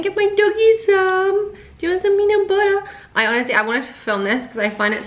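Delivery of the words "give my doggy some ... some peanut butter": put-on high, louder doggy baby talk. Owner offering her dog peanut butter in high baby talk. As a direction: coo at the dog in a high sing-song.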